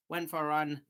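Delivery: wheezy and winded after a run. Real, not acted. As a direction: talk short of breath, wheezing. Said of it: "sorry if I sound very wheezy".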